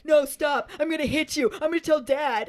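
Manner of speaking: a hammed-up spoiled cousin; whiny threats. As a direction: imitate a bratty kid threatening to tell. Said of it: "Dudley's still like".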